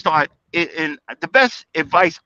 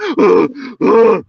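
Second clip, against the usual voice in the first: winded gasp; 3 semitones higher, 5 dB louder than usual. Retold or imitated. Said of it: "I was so out of breath".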